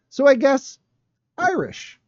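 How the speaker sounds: high, shrugging mock director; tossed-off guess in a lifted voice; mock shrug. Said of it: "And I said".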